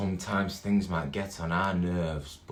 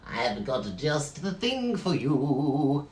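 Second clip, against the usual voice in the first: slow, lightly voiced shopkeeper; pet shop owner voice; 6 semitones higher, slower than usual. Pet shop skeleton soothes big and little, slow shopkeeper voice. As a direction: drawl it like a shifty shop owner. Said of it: "That's how he talks".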